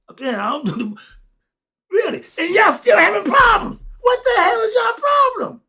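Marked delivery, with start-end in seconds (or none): 1.9-5.6 high, loud, cracking near-cry shout; excited outburst. Real, not acted. Preacher exasperated at Christians, real excited shouting. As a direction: let it burst out of you. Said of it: "Sorry, I get excited".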